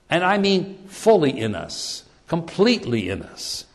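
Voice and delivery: ordinary delivery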